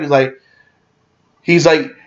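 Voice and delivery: ordinary talk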